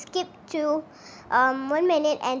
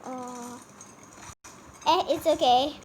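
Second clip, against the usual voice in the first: normal talking voice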